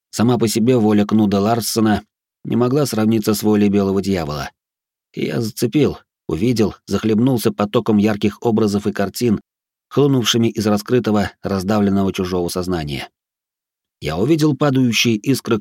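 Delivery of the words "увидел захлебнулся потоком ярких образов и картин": ordinary delivery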